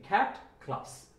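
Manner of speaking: ক্যাট, ক্লাস each with an airy "kh" opening; aspirated C. Shown as a demonstration. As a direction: puff air after the K in "ক্যাট, ক্লাস". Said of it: "উচ্চারণটা হবে খ এর মতো".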